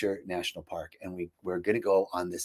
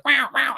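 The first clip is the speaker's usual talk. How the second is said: imitated squabble noise, high-pitched yapping; mock bickering; 12 semitones higher, 3 dB louder than usual. Friends bickering over the GPS, mock squabble. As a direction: squawk it like two friends sniping.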